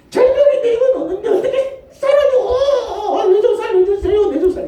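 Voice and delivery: high, loud, laughing desperate cry; desperate pleading. Acted. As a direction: beg for your life, half laughing. Preacher acts desperate believer on cliff. (절벽에 매달린 신자의 다급한 외침)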